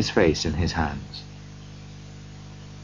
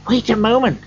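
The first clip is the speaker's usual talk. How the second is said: put-on high anxious old man; an urgent old miser; Scrooge; 12 semitones higher than usual. A narrator's reading. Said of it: "said Scrooge".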